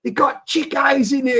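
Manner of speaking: high excited American voice; bubbling over with news; lightly voiced eager student; excited kid. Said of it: "I go".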